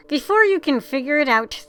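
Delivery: plain reading voice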